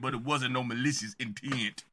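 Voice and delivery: low-pitched imitation of a rapper; crisp take-off of a rapper's denial. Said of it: "why did he get the T I right".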